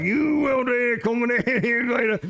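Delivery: mock Cajun accent calling out to the gator. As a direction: Cajun gator man hollering at the alligator. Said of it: "the deepest Cajun accent".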